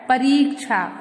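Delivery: "परीक्षा" with a long "ree"; correct pronunciation. Shown as a demonstration. Teacher giving the right word, correctly pronounced. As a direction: stretch the i in "परीक्षा": pa-reek-sha. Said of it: "क्या होता है?".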